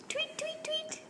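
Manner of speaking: tiny, very high, soft chirps; playful whispered bird call; birdie tweets. Pretend. Imitating a bird for toddler.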